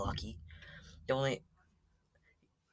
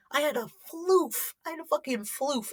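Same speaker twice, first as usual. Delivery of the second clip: much higher, louder squeal of disbelief; squeaky high exclaim; 5 dB louder than usual. Real, not acted. Telling viewers about the confiscated floof, squeaky high outrage.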